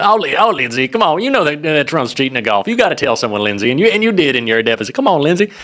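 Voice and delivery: put-on Southern accent, teasing a senator. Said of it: "I'll probably bust out the Southern accent".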